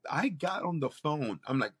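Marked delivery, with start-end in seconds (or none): none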